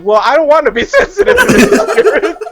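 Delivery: high, loud mock complaint through laughs; laughing protest. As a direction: protest comically while cracking up. Retells protest to mom, laughing.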